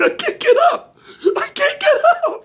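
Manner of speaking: high, loud half-laughing panic; panicked laughing cry. Retold or imitated. Pinned on the hotel floor, high panicked laughing cry. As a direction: wail it while laughing helplessly. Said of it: "I'm going".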